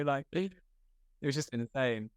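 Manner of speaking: ordinary talk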